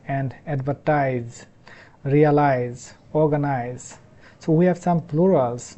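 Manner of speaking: usual speaking voice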